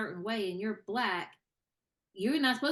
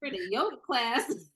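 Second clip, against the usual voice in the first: sarcastic aside; 6 semitones higher, 6 dB louder than usual. Retold or imitated. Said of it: "And I'm like".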